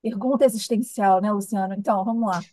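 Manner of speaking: usual speaking voice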